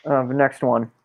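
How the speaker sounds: usual speaking voice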